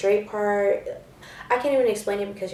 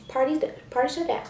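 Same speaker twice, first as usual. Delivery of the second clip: ordinary talk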